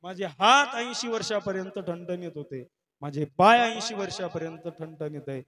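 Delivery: imitating an old father's impassioned, loud outcry; loud lament. Old father explaining his tears to his daughter, loud lament. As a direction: declaim the grievance at full volume, pitch often lifted. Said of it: "मी मोठमोठ्याने रडतोय".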